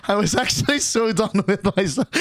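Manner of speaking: giggling through it; laughing at herself. Real, not acted. Laughing at herself to chat about the night. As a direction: laugh as she admits it.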